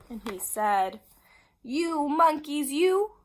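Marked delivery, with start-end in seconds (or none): 1.6-3.2 loud, slow finger-wagging scold; storybook peddler telling them off. A narrator's reading. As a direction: scold the naughty monkeys, drawn out. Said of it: "he said, shaking a finger at them".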